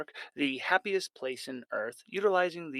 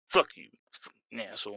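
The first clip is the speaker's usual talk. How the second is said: angry retort; 3 semitones lower than usual. Acted. Riley snaps at rival.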